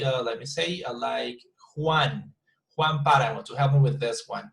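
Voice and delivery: ordinary delivery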